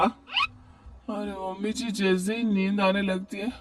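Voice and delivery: slow, soft drowsy complaint; daughter-in-law woken again, slow and softly whining; sleepy whine. Acted. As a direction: drag the words out half asleep.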